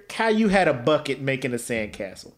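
ordinary delivery

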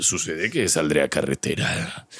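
deep villain tone at gas station. Retold or imitated. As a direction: order gas like a suave villain. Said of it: "voz de villano y habla".

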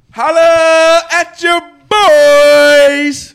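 hyped shout-out to the listeners; drawn-out shout-out. Real, not acted. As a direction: stretch it out like a hype call.

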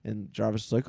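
usual speaking voice

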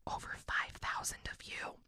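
hushed whisper; whispered aside. Real, not acted. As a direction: drop to a secret whisper. Aside about listener count.

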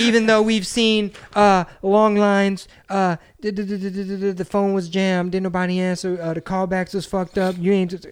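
hammed-up long-winded questioner; mock rambling reporter. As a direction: parody a reporter cramming in a speech. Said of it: "inject their own answer into the fucking question".

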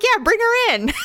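high voice breaking into a laugh; laughing mock cheer. Imagining the sister visit, high and laughing. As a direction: chirp it out then laugh. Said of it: "I'd be like".

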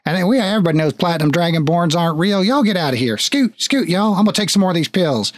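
high-pitched father shooing off a hallucination; agitated papa. Acted. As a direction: rattle on, convinced none of it is real. GM plays father dismissing the hallucination.

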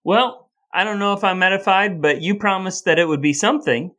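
usual speaking voice

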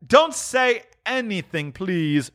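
high, slow, drawn-out plea; retort. Acted. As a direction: hush him with a slow, high appeal. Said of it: "retorted Scrooge".

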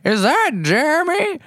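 put-on very high, loud, slow old man voice; Grandpa Bob impression. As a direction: demand it like a cranky grandpa. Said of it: "he's like".

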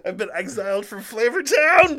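loud laughing mock despair; mock lament. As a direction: wail it through laughter.

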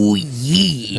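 put-on wrestler catchphrase voice; Randy Savage. Co-host answering the name Randy Savage, in the wrestler's voice. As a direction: do a drawn-out wrestler impression. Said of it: "start doing the wrestler voice".

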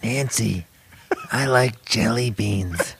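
dreamy old president, hammed up; Ronald Reagan impression. Host voices Reagan telling his wife, slow and dopey.

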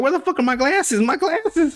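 high-pitched panicked cartoon voice; mock frantic. Teller voicing Velma without her glasses, mock frantic.

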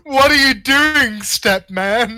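high, loud, drawn-out mock protest; hammed-up porn-star whine; porn parody. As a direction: stretch it out in fake shock. Porn parody step-relative line.

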